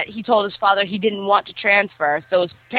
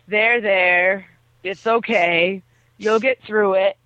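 lower, slow soothing voice; mock consoling; 3 semitones lower, slower than usual. Kenji consoles the heartbroken bully, mock soothing. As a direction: comfort him like a patient buddy.

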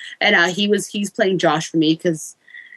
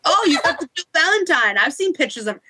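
put-on Jamaican accent; 7 semitones higher than usual. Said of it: "he sounds like a Jamaican".

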